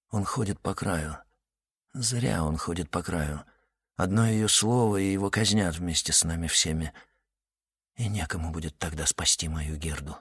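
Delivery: soft, low worried thought; quiet inner thought. A narrator's reading. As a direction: think it to yourself quietly.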